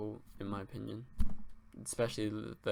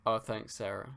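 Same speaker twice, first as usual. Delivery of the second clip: normal talking voice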